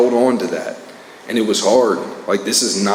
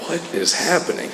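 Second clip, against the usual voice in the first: high, baffled question, a touch softer; stunned disbelief; 8 semitones higher, 4 dB quieter than usual. Retold or imitated. Retelling his shock at the sponsor's turn, high and baffled. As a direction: pitch it up in mock bewilderment. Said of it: "And I was like".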